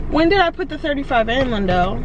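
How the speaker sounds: loud, high and fast; defensive. Real, not acted. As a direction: fire back defensively. Arguing over gas money.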